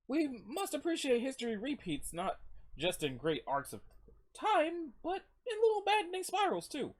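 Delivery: high-pitched solemn pronouncement; foreboding character. Acted.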